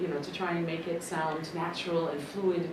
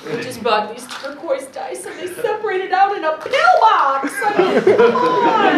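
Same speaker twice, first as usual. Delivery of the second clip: excited; 17 dB louder, slower than usual. Real, not acted. Gushing over new dice.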